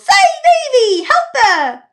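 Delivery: very high, loud shout with a laugh; frantic yell. Acted. Jack chasing Evie, yelling for help. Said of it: "Jack runs after me and yells".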